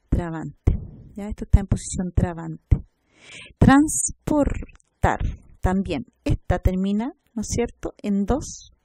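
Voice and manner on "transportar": Transportar very slow, in three beats; syllable by syllable. Shown as a demonstration. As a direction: stretch Transportar out with pauses between syllables. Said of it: "son tres sílabas".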